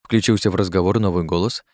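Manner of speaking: plain reading voice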